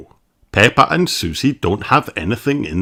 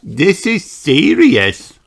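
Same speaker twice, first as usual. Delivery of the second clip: serious little doctor voice; 6 semitones higher, 3 dB louder, much slower than usual. Acted. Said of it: "she says".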